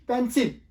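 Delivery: "पेंसिल" loud and high as PEN-sil; correct pronunciation. Shown as a demonstration. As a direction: open e, clear i in पेंसिल. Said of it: "ये actual word है".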